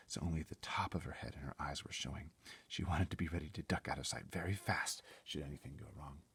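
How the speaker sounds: ordinary delivery, nothing marked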